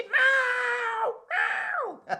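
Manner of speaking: high, loud, mewling cat cry; crying kitty cat. Acted. Performer to children, kitten stuck up a tree crying. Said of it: "He'd say".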